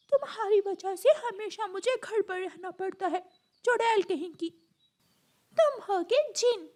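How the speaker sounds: put-on high, cross child-bird voices; squabbling siblings. Siblings blaming each other at home, squabbling. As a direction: trade childish insults crossly.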